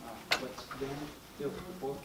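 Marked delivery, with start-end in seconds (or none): none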